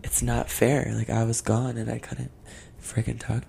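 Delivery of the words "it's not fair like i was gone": ordinary delivery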